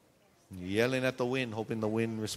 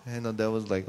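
ordinary talk, nothing marked

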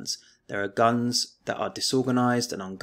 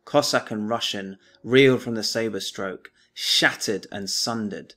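poem recital; 6 dB louder than usual. Acted. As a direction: recite it like a stirring war poem. Teacher recites the poem aloud.

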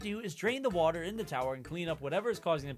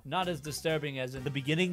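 ordinary talk

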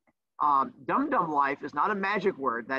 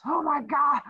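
mock student; 10 semitones higher, 3 dB louder than usual. Imitating overwhelmed student.

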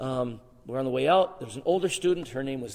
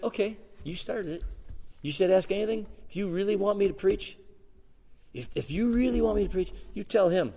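higher, daring and cocky; cocky challenge; 6 semitones higher than usual. Retold or imitated. Cocky young man challenging God, daring. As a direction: dare God to prove it.

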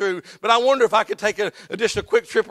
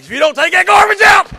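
put-on loud yelling at the kids about garbage; angry shout at home; 8 semitones higher, 9 dB louder than usual. Preacher acting out yelling at family, shouting. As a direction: bellow the order across the house. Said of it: "It's like".

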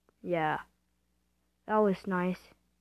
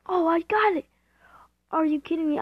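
excited; 8 semitones higher, 5 dB louder than usual. Real, not acted. Grabs item at last second.

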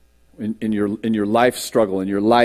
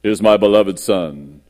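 voice of God; 4 semitones lower, 5 dB louder, slower than usual. Acted. Heavens open over the baptism, booming divine proclamation. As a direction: thunder the opening, end low and solemn. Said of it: "this voice comes out and says".

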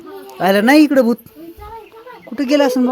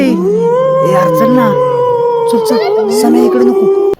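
put-on much higher, pleading, hurrying the kids off; urgent scared call; 11 semitones higher, 5 dB louder, much slower than usual. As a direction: call them away in a frightened rush. Urging kids away from ghost. (घाबरून उंच आवाजात घाई)

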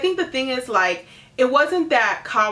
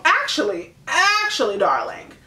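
sassy correction; 4 semitones higher, 3 dB louder than usual. Real, not acted.

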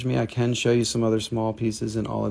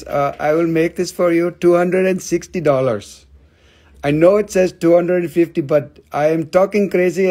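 higher, louder mock Indian hawker; Indian accent; 4 semitones higher, 6 dB louder than usual. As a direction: haggle loudly in a put-on Indian accent. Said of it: "I'll talk like I'm from India too".

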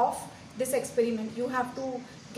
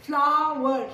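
Flower louder, in two stretched beats; syllable by syllable; 6 dB louder than usual. Shown as a demonstration. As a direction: push out both syllables of Flower loudly. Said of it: "Two syllables".

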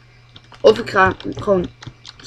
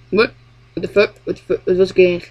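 usual speaking voice

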